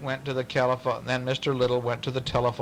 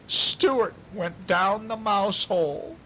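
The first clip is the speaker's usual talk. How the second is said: frightened wife; 9 semitones higher, slower than usual. A narrator's reading. Wife announces, slow, high.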